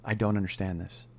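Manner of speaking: low, soft voice of a wife siding with him; quiet sympathy. Retold or imitated. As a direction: keep it down, commiserate privately. Wife privately reassures upset husband.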